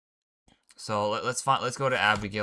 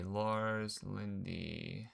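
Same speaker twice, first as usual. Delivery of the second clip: drawn out slowly and softer, rising; softer slow musing; 8 dB quieter, much slower than usual. Real, not acted. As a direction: trail off puzzled with a lift. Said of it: "Let me figure something out".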